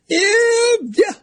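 high falsetto yeah; squeaky yeah. Real, not acted. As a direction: squeal it in mock agreement. Sarcastic reply to listener text.

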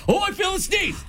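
high shouted "Oh, I feel the sneeze"; loud cue shout. Shown as a demonstration. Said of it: "So when I say, "Feel the sneeze".

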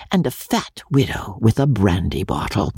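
usual speaking voice

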